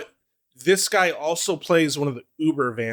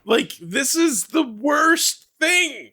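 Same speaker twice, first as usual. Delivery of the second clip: excited; 8 semitones higher than usual. Real, not acted. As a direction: drag it out, thrilled and creeped out. Gushing about the scariest demon.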